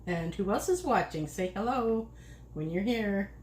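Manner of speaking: ordinary talk, nothing marked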